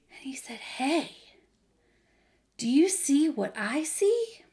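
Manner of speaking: animated storytime narration with big emphasis; lively Bible storyteller, playing up the drama; children's story reading. A narrator's reading. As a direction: read it expressively to young children.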